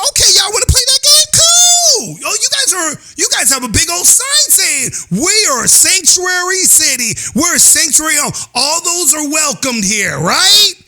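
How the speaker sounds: mock DeSantis taunt. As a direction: throw it back at them with a shout. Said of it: "DeSantis said".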